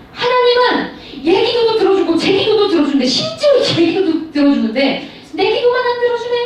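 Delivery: high-pitched imitated complaint; mock grievance. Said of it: "뭐라고 생각하냐면".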